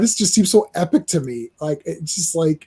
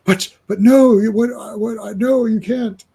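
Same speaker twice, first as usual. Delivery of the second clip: high, louder panicked protest; distressed plea; 6 semitones higher, 6 dB louder than usual. Retold or imitated. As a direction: stammer it out in panic. Distressed character in comic.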